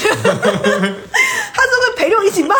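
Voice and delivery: slow, laughing through the words. Real, not acted. Telling the host about her venting friend, laughing slowly. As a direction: let the laugh carry the line, slowly.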